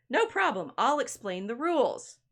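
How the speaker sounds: lightly acted, high, lilting offer to help; obliging Gentleman Bear. Gentleman Bear offers to explain the rules to a newcomer, lightly acted and obliging.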